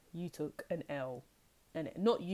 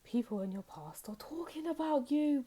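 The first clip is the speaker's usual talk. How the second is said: much higher, theatrical announcement; dramatic reveal; 8 semitones higher than usual. Shown as a demonstration. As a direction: announce it like big juicy news.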